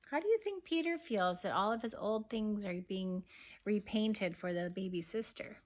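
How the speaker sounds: ordinary delivery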